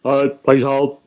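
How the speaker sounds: curt, grudging receptionist; put-on bored hold request; unhelpful brush-off. Shown as a demonstration. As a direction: dismiss the caller in a hurry. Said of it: "a very different response from".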